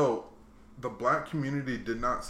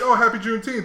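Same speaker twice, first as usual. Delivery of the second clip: lightly voiced [name]; 8 semitones higher, 7 dB louder than usual. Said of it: "if [name] was like".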